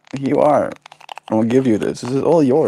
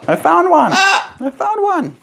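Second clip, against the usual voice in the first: much higher, slowing down on the repeat. Real, not acted. Owner finds one last treat for her bird, high delighted coo. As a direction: sing it up high like a found treasure.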